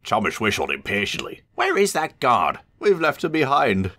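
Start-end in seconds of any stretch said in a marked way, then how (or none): 1.5-2.6 slow, high, cross demand; impatient. A narrator's reading. Impatient engine waiting. 2.8-4.0 croaky high coach voice; croaking. A narrator's reading. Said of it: "croaked Annie".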